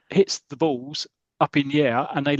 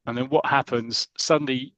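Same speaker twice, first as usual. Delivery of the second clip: ordinary talk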